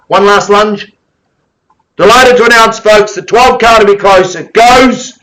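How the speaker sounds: loud, high, urgent auctioneer call; frantic trading floor shouting. Real, not acted. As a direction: drive the room to the final hammer. Auction climax, calling the sale.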